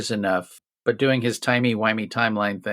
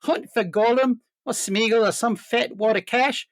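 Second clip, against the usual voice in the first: put-on higher Kiwi accent, Peter Jackson; put-on New Zealand voice, higher; 10 semitones higher than usual. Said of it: "a very objectionable New Zealand accent".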